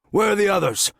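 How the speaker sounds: high-pitched, demanding question; urgent demand. A narrator's reading. Armed officer to the man on the ground, demanding. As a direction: demand an answer from the suspect. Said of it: "the officer demanded".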